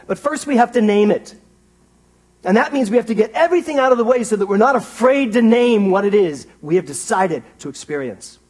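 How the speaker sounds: loud, high, driving sermon voice; fired-up pulpit delivery; full-force preaching. Acted. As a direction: build it up and preach it out to the room.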